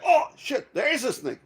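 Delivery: retold shout. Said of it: "So I'm fucking shouting".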